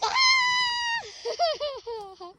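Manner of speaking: delighted laughing scream; high shrieking laugh. Real, not acted. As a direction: burst into a squealing laugh. Dog was waiting for her.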